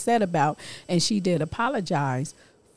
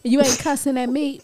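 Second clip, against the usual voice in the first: mom's scolding; 6 semitones higher than usual. Retold or imitated. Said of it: "She be like".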